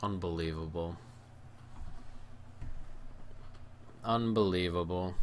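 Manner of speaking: low, soft, crushed; deflated disbelief. Real, not acted. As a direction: sink into despair. After conceding late goal.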